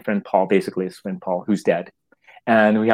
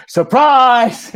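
much higher and louder joyful shout; shouted surprise; 14 semitones higher, 8 dB louder than usual. Retold or imitated. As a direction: jump out and yell it. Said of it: "And Paul goes".

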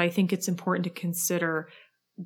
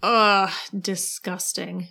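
annoyed ugh; much slower than usual. Real, not acted. Disgust at Revlon news.

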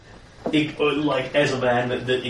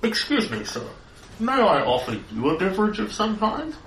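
high, prim robot butler; polite machine servant voice; 9 semitones higher than usual. Acted. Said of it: "more of a robot voice".

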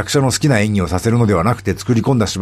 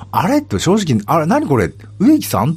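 imitating a puzzled reaction, higher pitch; mock surprise, questions pitched up; startled double take; 7 semitones higher than usual. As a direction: relive a baffled "is that him?" moment. Acts out own startled first reaction.

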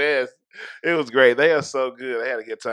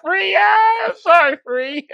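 big laughing shout of the name; yelling across the room; 7 dB louder, much slower than usual. Pretend. Shouting the mistaken name gleefully.

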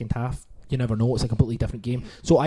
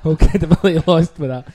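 laughing; 2 semitones higher, 10 dB louder than usual. Real, not acted. Laughing repeat.

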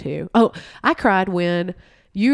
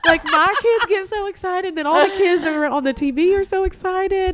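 real tears, voice climbing much higher and louder; tearful; 12 semitones higher, 4 dB louder than usual. Real, not acted. Telling friends about the Santa parade, tearing up for real. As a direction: let the voice catch high as the tears come. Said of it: "Are you tearing up right now?".

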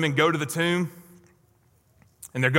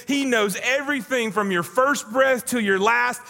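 fervent preaching; 5 semitones higher than usual. Acted. Pastor proclaiming to the church, fervent preaching. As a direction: lift each line higher.

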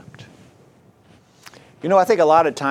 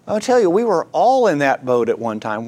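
ordinary talk, nothing marked

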